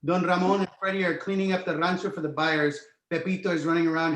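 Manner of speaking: normal talking voice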